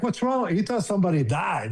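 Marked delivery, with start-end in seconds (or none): 0.0-0.5 higher, startled worried ask; worried question. Retold or imitated. Teammate seeing him bawl, asking in higher alarm. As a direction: sound alarmed for a friend. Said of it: "And Matt goes like".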